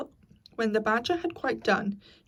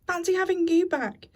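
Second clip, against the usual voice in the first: excited; 9 semitones higher, 5 dB louder than usual. Acted. Otter greets Toad gleefully.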